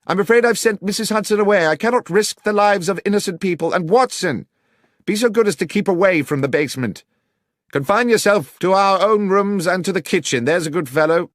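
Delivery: high, loud and fast calling. A narrator's reading. Holmes calls orders up from the basement, loud and high. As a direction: project firmly through the door.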